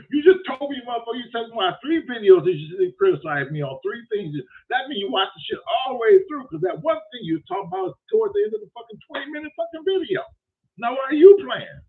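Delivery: high-pitched, indignant rant; worked-up retelling of a comeback; retold heated argument. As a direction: argue the point, exasperated.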